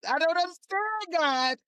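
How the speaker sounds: high, anguished plea to God; pleading prayer. Retold or imitated. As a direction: cry out to God in confusion. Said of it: "'Cause I'm saying".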